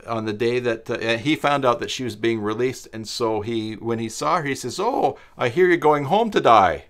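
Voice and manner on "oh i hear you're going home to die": imitating a New Zealand chaplain, higher and louder; a cheery New Zealand today that sounds like die; loud New Zealand-accented cheerful remark. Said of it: "He had a New Zealand accent".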